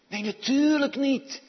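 loud, high, forceful dismissal; emphatic denial. Real, not acted. Emphatic rejection of whispering. (luid en stellig ontkennend)

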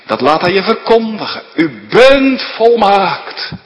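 loud, high, slow pulpit proclamation; preaching at full force. Acted. Preacher calling the church in Waarder to Christ, loud and high. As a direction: ring out the gospel call over the congregation.

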